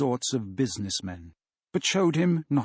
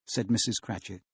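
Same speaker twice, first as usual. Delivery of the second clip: ordinary delivery, nothing marked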